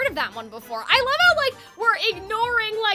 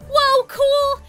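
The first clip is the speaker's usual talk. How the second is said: amazed; 9 semitones higher, 8 dB louder than usual. Acted. Seeing the underwater room.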